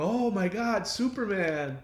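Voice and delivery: high, gushing mock fan awe. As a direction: gasp it out like a starstruck fan. Fans gushing over Superman.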